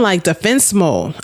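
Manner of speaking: usual speaking voice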